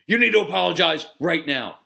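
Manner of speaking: loud, high, stern order to apologize; stern demand. Retold or imitated. Parent forcing a child to apologize, sternly.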